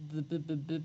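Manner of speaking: stuck repeating the word the; stutter. Real, not acted. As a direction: stammer on the, over and over. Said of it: "as demonstrated".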